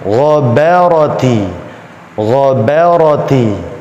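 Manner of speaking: GHABARATI as "gho-bæ-ro-ti"; slow ghabarati, heavy gha and ra, light ba; alternating thick and thin. Shown as a demonstration. Teacher drilling an example word, thick-thin Ghabarati. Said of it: "Gha tebal, ba tipis, ra tebal".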